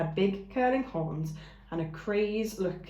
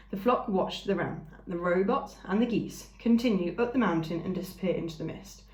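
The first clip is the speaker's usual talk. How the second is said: ordinary delivery, nothing marked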